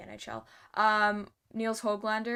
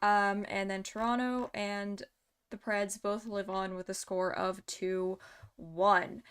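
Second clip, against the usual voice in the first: ordinary talk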